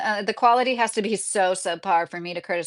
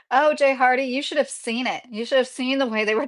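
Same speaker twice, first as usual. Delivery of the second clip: ordinary delivery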